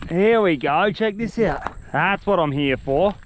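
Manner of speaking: usual speaking voice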